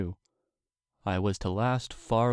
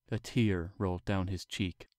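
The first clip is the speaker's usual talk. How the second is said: plain reading voice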